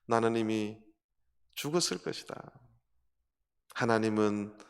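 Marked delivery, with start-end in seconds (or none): none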